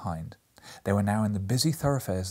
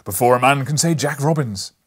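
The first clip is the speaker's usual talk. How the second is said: jolly Fezziwig shout; 3 semitones higher, 11 dB louder than usual. A narrator's reading. Fezziwig calls his boys to stop work, loud and hearty. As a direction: boom it like a merry old master. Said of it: "cried old Fezziwig".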